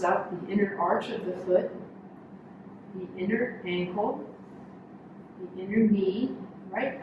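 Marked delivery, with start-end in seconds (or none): none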